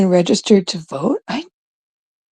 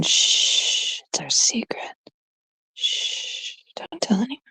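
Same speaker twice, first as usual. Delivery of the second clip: conspiratorial whisper, barely voiced; playful secret whisper; 3 semitones higher, 11 dB quieter, slower than usual. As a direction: hush them and share a secret. Telling viewers a secret.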